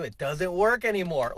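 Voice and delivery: normal talking voice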